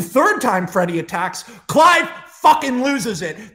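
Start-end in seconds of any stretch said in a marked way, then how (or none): 1.7-3.5 loud, higher yell, slow; dramatic slow shout. A narrator's reading. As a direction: bellow it out as he snaps. Rage erupts.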